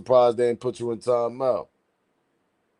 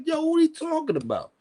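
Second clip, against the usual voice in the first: high mock callout. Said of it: "I'm like".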